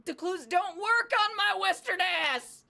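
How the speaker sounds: high, loud, slow self-mocking yell; high, loud protest, drawn out; exasperated outcry. Real, not acted. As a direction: yell it in comic despair. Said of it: "I gave you clue".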